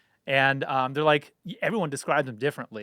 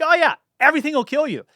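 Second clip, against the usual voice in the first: much higher and louder, hammed-up warning; mock scaremongering; 11 semitones higher, 7 dB louder than usual. Mocking how Australia is sold to Americans, high hammed-up alarm. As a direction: ham up the doom like a scary travel pitch. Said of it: "The way Australia is pitched to us is like".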